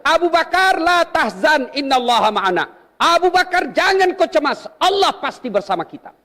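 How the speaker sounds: high, gentle comforting of a friend; calm a frightened friend; reassuring. Retold or imitated. Prophet comforts Abu Bakar. (menenangkan sahabat yang cemas)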